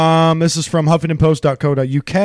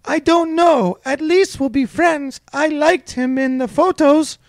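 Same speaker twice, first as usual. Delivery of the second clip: higher, lightly put-on Russian accent; mock Russian woman; 11 semitones higher than usual. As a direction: quote her in a coy Russian voice, higher. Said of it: "she said".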